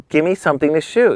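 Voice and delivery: high-pitched mock plea; begging. Young photographer asking a magazine for work, pleading. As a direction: re-enact a pushy, pleading request. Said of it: "I begged Hollywood Life".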